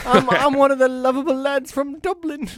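high, hammed-up Irish lad voice; Irish accent. McGregor voiced as a lovable Dublin lad, in a mock Irish accent. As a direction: squeal it like a cartoon Dublin lad. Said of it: "He's Irish".

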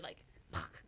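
breathy whispered curse under the breath; whispered swear. Real, not acted. Friend telling movie plot, whispers the curse in shock. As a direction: hush it out like a shocked aside.

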